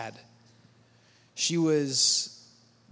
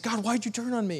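higher, wounded protest; hurt complaint to God; 6 semitones higher, faster than usual. Retold or imitated. Believer accusing God of turning away, higher and hurt. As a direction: ask it like a betrayed child. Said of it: "we're just like".

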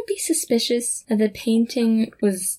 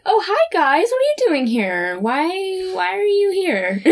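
retold awkward surprise, higher pitch; surprised greeting; 8 semitones higher than usual. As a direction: imitate a puzzled hello, jumping up in pitch. Voicing a surprised Sarah's greeting.

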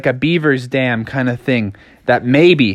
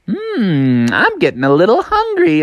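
higher, sly creature voice; hungry mosquito; 5 semitones higher than usual. A narrator's reading. Mosquitoes licking their lips at Froggy, high hungry purr.

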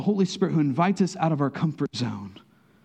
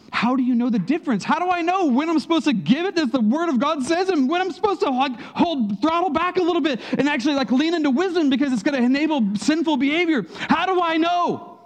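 building plea; 14 semitones higher, 4 dB louder than usual. Acted. Preacher's rising anguished questioning.

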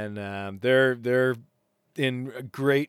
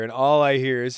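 ordinary talk, nothing marked

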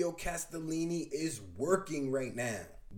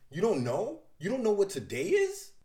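a touch of hammed-up disbelief, higher; lightly voiced shocked girl; higher mock-innocent disbelief; mock surprised Harley; 6 semitones higher, 4 dB louder than usual.

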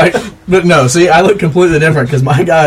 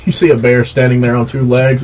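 ordinary talk, nothing marked

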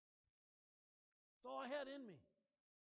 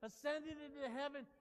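rising to a loud shout; building sermon climax; 4 semitones higher, 10 dB louder than usual. Acted. Pastor driving home the gospel to the church, building to a shout. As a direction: build and build to a roaring close.

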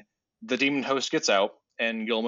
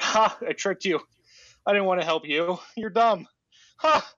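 high, louder gloating sneer; taunting demon; 8 semitones higher, 4 dB louder than usual. Retold or imitated. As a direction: crow over the trick like a smug demon. Demon host quote, mocking the hero.

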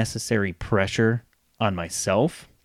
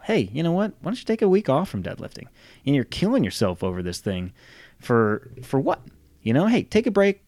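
higher, gently coaxing buddy voice; supportive gym partner; 6 semitones higher than usual. Retold or imitated. Gym partner urging a week off, higher coaxing voice. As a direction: talk a frustrated friend down, pitched up.

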